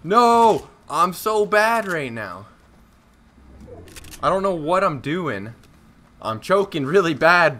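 loud, high dismayed shout; frustrated yell. Real, not acted. Losing the fight.

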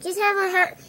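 loud happy holiday shout; excited cheer. Real, not acted. Kid cheers happy Thanksgiving to viewers, loud and excited. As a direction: call it out to everyone, beaming.

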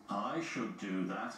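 lightly voiced big engine, low; Gordon the engine. A narrator's reading. Gordon wants James's job, low grumble.

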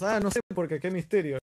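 imitating people baffled by a mystery; mock puzzlement. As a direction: throw up a loud, high "who knows?". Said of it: "Y decimos".

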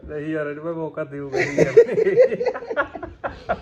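laughing while talking. Real, not acted. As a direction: laugh through the words. Teasing banter, laughing.

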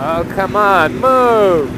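loud, high, exasperated yell; real impatient outburst at traffic; frustrated shout. Real, not acted. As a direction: bark it out at someone in your way. Frustrated at something blocking the way.

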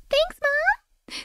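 very high cutesy cat voice; voicing the cat. Streamer voicing her cat thanking her, high squeaky voice. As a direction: speak for the cat, high and sweet. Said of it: "she walks in and she's like".

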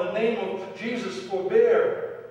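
loud, high retold cry; insistent yell. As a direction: keep yelling over the fight to stop it. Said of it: "he continued to yell".

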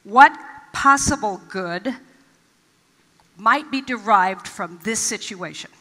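demonstrating the question, slow and weighty; slow, deliberate question. Shown as a demonstration. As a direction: model the question for the congregation to repeat. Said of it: "ask this question repeatedly".

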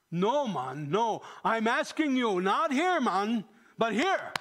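emphatic retold correction, loud and high; serious insistence. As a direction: push back with urgent force, then slow down on "but here". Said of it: "he was very serious about it".

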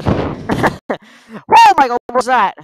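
shocked laugh. Real, not acted. Jump scare, laughing in shock.

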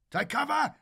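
high, loud urgent scream. Acted. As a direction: scream the warning. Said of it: "he screamed".

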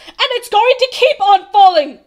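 high, loud angry outburst; shouted. A narrator's reading. Bartholomew can hold his tongue no longer, shouting. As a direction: shout it, unable to hold back. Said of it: "he shouted".

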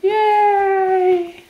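high, loud yay; excited cheer. Real, not acted. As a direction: cheer with delight.

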